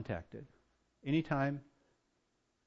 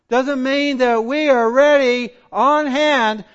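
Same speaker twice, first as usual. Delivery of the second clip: raised, ringing preacher's cry; full-force preaching; 9 semitones higher, 13 dB louder than usual. Real, not acted. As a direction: drive it home loudly to the congregation.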